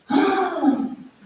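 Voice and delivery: big high theatrical intake of breath; dramatic gasp. Retold or imitated. Imitating Guruji at the door, a dramatic high gasp. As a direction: gasp like a shocked master. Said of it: "Guruji was very dramatic sometimes".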